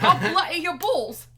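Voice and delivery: put-on high, shouted comic Scottish accent; mock Scottish accent. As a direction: yell a silly threat in a botched Scottish accent. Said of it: "that's more like Old English".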